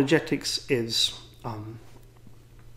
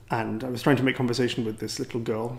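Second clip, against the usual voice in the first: normal talking voice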